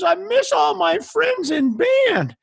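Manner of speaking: slow, high, loud mock wail; hammed-up bawling, drawn out; mock crying student. Crying student tells band director why she is unhappy, hammed-up loud wail. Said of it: "And she starts crying".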